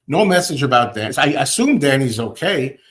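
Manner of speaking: ordinary talk, nothing marked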